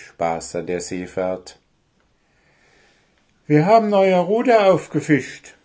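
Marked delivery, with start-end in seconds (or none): 3.5-5.5 high, loud proud call. Acted. Elf girls call to boat proudly. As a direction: shout the news across the water. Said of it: "riefen die beiden Wichtelfräuleins".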